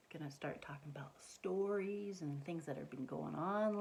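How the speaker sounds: whisper. Shown as a demonstration. Whispering about circle time.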